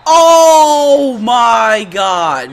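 loud, very slow, drawn-out cry; exasperated yell. Real, not acted. Gamer upset at the play, long exasperated yell. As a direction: stretch every word in loud disbelief.

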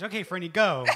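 loud, bright command; cheery cue. Retold or imitated. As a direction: call out to start the show. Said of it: "she's like".

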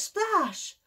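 ordinary delivery, nothing marked

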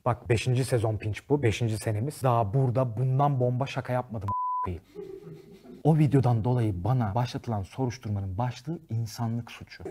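ordinary delivery

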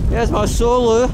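calling out over the wind. Real, not acted. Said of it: "Start again".

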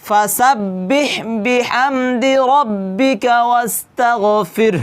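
slow chant that stops on ওয়াস্তাগফির; Quran recitation with a pause. Shown as a demonstration. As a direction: recite slowly and take a breath at the end. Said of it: "এরকম করে কি করতে হবে? নিঃশ্বাস নিতে হবে". (থেমে শ্বাস নিয়ে তিলাওয়াত)

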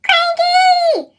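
high, loud shout across the docks; sharp high piercing cry; urgent whistle call. A narrator's reading. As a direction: call out to the crane in alarm. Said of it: "he whistled".

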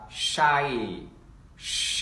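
"shy" drawn out into a long ai; long I demo. Shown as a demonstration. Teacher spells out long I for class, elongated shy. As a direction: slowly stretch each "shy". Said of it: "chúng ta sẽ đánh vần như sau".

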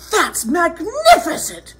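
high, gushing admiration; storybook princess cheering at a high pitch; excited praise. Acted. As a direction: flatter the dragon grandly. Elizabeth cheers the dragon on.

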